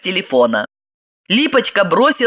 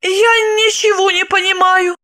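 confused admission; 13 semitones higher than usual. A narrator's reading. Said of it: "призналась Липочка".

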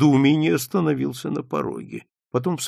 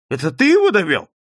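fast, high, loud demand; angry accusation; 8 semitones higher, 8 dB louder, faster than usual. A narrator's reading. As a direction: round on your son. Father rounds on his son. (гневный окрик)

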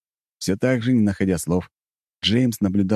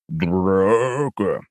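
yawning sleepy bear. Acted. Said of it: "Сонный пчела-медведь".

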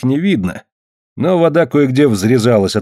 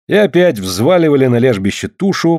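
ordinary delivery, nothing marked

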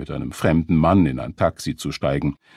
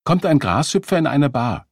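telling a dad joke; 7 semitones higher, 5 dB louder than usual. Acted. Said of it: "Randy erzählte einen Witz".

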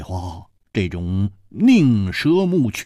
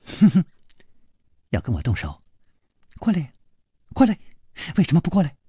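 scornful, goading taunt; cold sneer; 5 semitones higher, faster than usual. Acted. Masked stranger goading the hero to fight, cold sneer. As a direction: sneer and dare him to come. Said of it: "冷笑着又说".

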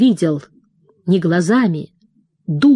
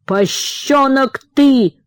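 high, slow squeal of contempt; shrieking father; 6 semitones higher, slower than usual. A narrator's reading. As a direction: shriek it down at the boy. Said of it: "взвизгнул он".

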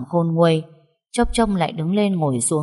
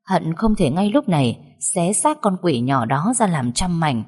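usual speaking voice

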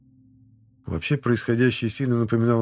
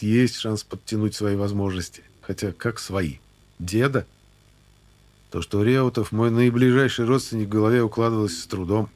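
ordinary delivery

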